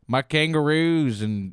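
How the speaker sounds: slow Australian sliding into Indian; botched Australian accent. Acted. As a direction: attempt Australian, landing on Indian. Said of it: "Indian impression is really sounding good".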